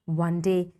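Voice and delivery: usual speaking voice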